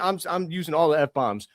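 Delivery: usual speaking voice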